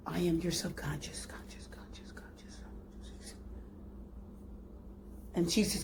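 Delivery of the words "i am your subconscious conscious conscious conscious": soft whisper fading away into an echo. Retold or imitated. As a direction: breathe each word so it trails off into distance. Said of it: "says in a voice that echoes".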